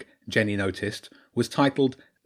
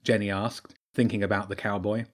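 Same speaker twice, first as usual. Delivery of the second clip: plain reading voice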